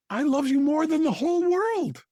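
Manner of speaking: high, eager flattering daughter. Acted. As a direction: gush it out to win favour. Second daughter flatters the king.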